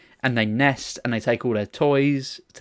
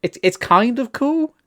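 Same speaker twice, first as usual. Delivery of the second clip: coping; 11 semitones higher, 4 dB louder, slower than usual. Real, not acted. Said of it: "sound like you're coping".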